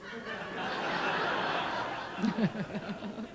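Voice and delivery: quiet rising chuckle; soft laugh. Real, not acted. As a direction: chuckle softly at the joke. Laughs after the joke.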